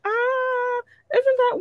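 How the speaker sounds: high excited mock voice; excited new-listener voice. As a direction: squeal like a delighted fan spotting something new.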